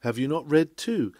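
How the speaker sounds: ordinary talk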